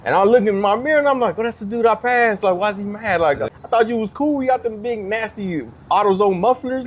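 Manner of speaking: high-pitched, puzzled protest; bewildered complaint. Retold or imitated. Comic retelling of his reaction to an angry driver, bewildered and high. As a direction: plead your case in a high voice.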